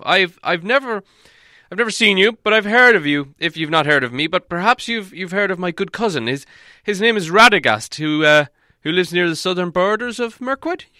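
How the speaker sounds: high, loud, stumbling explanation; hesitant, placating wizard. A narrator's reading. Wizard seeking favour with huge man, high and halting.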